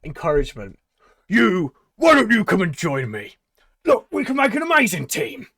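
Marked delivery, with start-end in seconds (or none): 1.3-5.5 loud, high barker's call; rally the crowd like a carnival barker; shouting showman. Acted. Tabaxi calling the crowd to join him, loud and high. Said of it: "shout words of encouragement".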